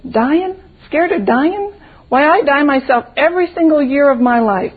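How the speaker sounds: high, rising disbelief as a little flower; incredulous posy. A narrator's reading. Posy scoffs at scared boy.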